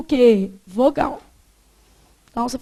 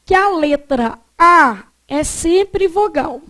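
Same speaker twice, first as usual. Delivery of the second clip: slow dictation, leaning on A and sempre; emphatic dictation; 2 semitones higher, 3 dB louder than usual. Shown as a demonstration. Teacher gives students a rule to note down, emphatic dictation. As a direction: dictate the rule firmly, word by word.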